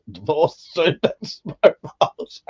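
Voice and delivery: slow, giggling reread of the chat line; laughing through it. Real, not acted. Slowly, cracking up mid-word. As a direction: streamer rereads a viewer's joke, laughing.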